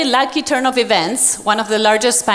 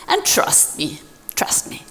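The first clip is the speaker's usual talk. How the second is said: dropped to a near whisper; softly insisting, almost unvoiced; hushed warning; 7 semitones lower, 6 dB quieter than usual. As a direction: lean in and whisper it like a secret. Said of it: "And he says".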